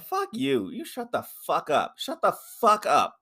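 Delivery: high-pitched mocking outburst; a touch of a high fake rage; mock angry yell. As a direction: snap at him in a high voice. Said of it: "I was just like".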